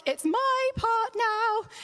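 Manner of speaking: high, slow and eager; hammed-up excited [name]; eager cue. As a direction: announce your turn with glee. [name] eagerly takes his cue.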